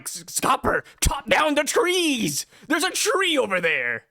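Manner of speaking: high, drawn-out villainous urging; cursed axe voice. Acted. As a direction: goad him like a possessed axe. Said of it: "maybe Scopper's axe is cursed".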